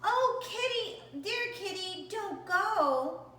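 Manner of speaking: high, pleading call, slow on the first words; shouted plea. Acted. Family calling after the runaway cat, pleading shout. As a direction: beg the cat not to leave. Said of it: "we shouted and cried".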